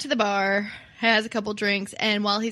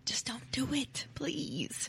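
near-whispered, pleading aside; small, hushed voice; hushed plea; 4 semitones higher, 11 dB quieter than usual. Real, not acted. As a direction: softly beg listeners, almost whispering. Mock plea to listeners about heroin.

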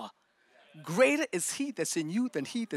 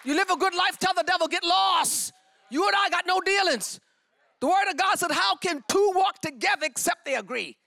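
preaching at full force; 9 semitones higher than usual. Acted. Preacher calling out to the congregation, full force. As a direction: preach it out to the church.

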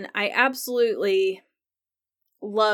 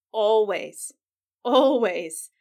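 each Always pressed harder and louder; emphatic repetition; 4 dB louder, slower than usual. Real, not acted. Fan insisting to viewers it's always about Jamie, emphatic. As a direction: hammer the word Always home, twice.

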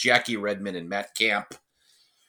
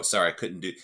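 usual speaking voice

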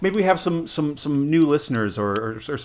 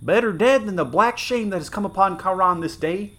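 higher, pained cry; groaning soldier; 8 semitones higher, 4 dB louder than usual. Acted. Wounded soldier groaning about the city's shame, pained. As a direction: push it out through the pain. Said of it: "he groaned".

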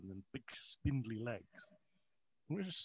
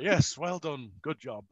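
louder, dry mock congratulations; grudging fake praise, louder; sarcastic praise; 3 semitones higher, 9 dB louder, slower than usual. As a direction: pat her on the back sarcastically. Mock-praising wife after spider catch.

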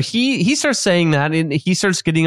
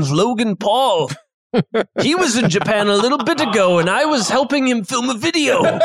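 raised chef voice, laughing through; chef character voice; 5 semitones higher than usual. Acted. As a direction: chuckle as the boastful chef.

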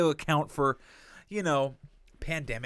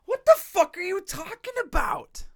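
much higher and louder, outraged disbelief; incredulous anger; 6 dB louder than usual. Retold or imitated. Retelling the call to the agent, an outraged shout. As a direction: blow up in disbelief at her. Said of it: "I'm like".

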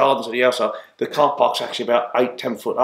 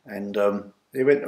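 usual speaking voice

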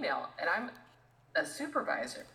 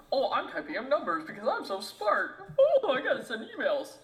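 higher, louder sneering mock voice of a desk worker; mocking smug office worker; 7 semitones higher, 6 dB louder than usual. Retail worker mocking desk workers as smug, loud high mock voice. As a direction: ham up a self-important office worker, nose in the air.

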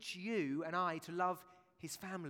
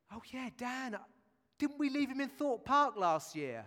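mock breezy mum; 7 semitones higher, 6 dB louder than usual. Preacher voices mother Ruth.

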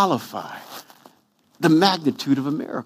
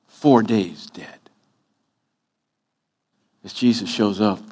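ordinary talk, nothing marked

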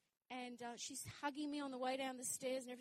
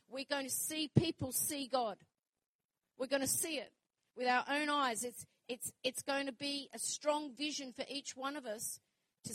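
louder and louder, preaching at full force; rising preaching; 2 semitones higher, 7 dB louder than usual. Acted. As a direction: build the promise to a rousing peak.

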